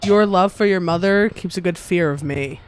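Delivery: usual speaking voice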